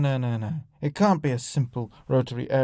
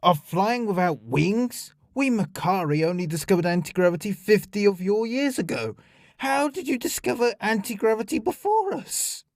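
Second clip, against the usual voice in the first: high-pitched, climbing to an outraged question; indignant disbelief; 6 semitones higher than usual. Acted. Alien confronts diplomat over flying crafts, incredulous. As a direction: build to an affronted demand. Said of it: "clearly surprised by his tone".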